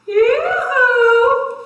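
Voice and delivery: high, loud sing-song yoo-hoo; shrill hammed-up call for help. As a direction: call her over from far across the field. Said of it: "The little girl called her dog".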